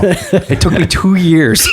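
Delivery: talking through a laugh. Real, not acted. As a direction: laugh while speaking.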